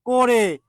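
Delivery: করে said "ko-re", closed o; o-sound pronunciation. Shown as a demonstration. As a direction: say করে with an o-kar, "ko-re". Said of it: "অসমাপিকা ক্রিয়ার উচ্চারণটা কি?". (করে-কে ওকার দিয়ে "কোরে" উচ্চারণ)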